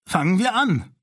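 put-on rally the group of children; calling out. Sina calls the children to start the game.

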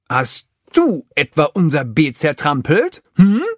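laute, empörte Anklage; anfahren. A narrator's reading. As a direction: fahr den Riesen wütend an. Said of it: "fährt er den Riesen an". (Tafiti fährt den Elefanten an, empört laut)

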